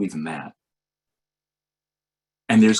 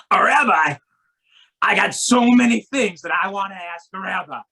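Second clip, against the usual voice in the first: high, loud eager driver voice; excited cab driver; 8 semitones higher, 6 dB louder than usual. Retold or imitated. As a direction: pepper the rabbi with eager questions.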